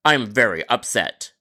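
ordinary delivery

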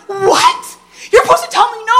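high, loud, laughing outrage; shocked disbelief. Retold or imitated. Telling her sister she should deny it, shocked and laughing.

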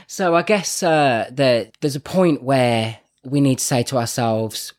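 normal talking voice

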